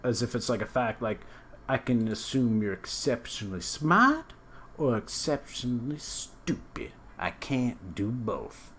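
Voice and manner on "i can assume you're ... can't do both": slow, drawn-out mock quote, words stretched; slow drawn-out imitation. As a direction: drag it out like a smug pronouncement. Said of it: "that really slow, drawn out".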